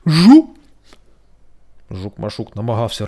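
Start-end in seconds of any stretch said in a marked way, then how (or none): 0.1-0.5 very loud high beetle buzz. A narrator's reading. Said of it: "заджичав голосно Жук". (гучне жужжання)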